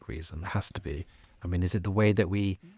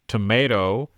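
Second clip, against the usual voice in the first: American "Tomato", tuh-MAY-toh, louder; American accent; 2 semitones higher, 7 dB louder than usual. Shown as a demonstration. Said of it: "I liked your accents there".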